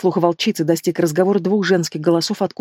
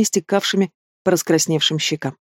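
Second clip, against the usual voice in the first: ordinary delivery, nothing marked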